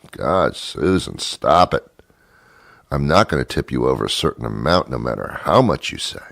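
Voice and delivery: normal talking voice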